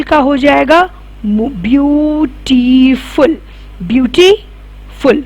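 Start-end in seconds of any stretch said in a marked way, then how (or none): 1.2-5.2 beautiful spelled out slowly, syllable by syllable; slow syllable demo. Shown as a demonstration. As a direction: draw out each syllable of beautiful for the class. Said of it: "दो syllable word है".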